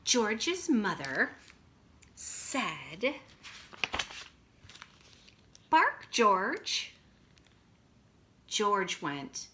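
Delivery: slow, lively picture-book reading; reading to children. A narrator's reading. Teacher reading a picture book to her class, slow and lively. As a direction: read it out big for kindergartners.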